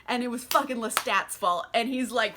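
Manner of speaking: ordinary talk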